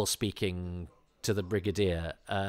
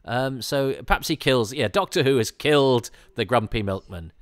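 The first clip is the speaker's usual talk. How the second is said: ordinary talk, nothing marked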